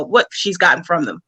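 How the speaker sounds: usual speaking voice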